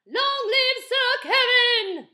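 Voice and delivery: slow, high, loud sung cheer; cheering crowd. A narrator's reading. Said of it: "the townsfolk cried".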